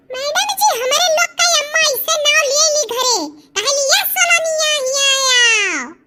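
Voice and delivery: high, sing-song schoolkid whine; pleading like a little village pupil; whiny village child. Acted. Child pupil whining to teacher. (मास्टरनी से ठुनकते गाँव के बच्चे की आवाज़)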